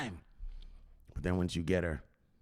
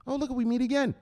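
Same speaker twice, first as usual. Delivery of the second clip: higher, playful fake-surprised hello; mock chance meeting; 11 semitones higher than usual. Suitor meeting Teresa again, higher mock-surprised greeting. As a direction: pretend it's a coincidence. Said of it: "So you'll see her there".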